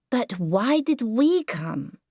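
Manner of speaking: a lightly voiced weary small boy; sleepy child, drawn out; tired little boy. Howard persists.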